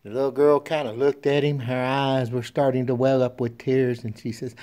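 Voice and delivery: ordinary delivery, nothing marked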